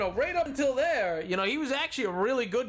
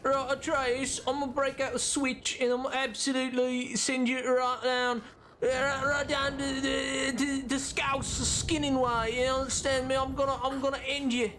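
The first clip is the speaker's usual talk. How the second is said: hammed-up British tough-talk; mock menace in overdone British; overdone British accent. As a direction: threaten in an exaggerated British accent. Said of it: "the British one, but overdone".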